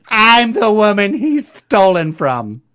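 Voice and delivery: the wronged woman, drawn out; imitating shop woman. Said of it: "She's like".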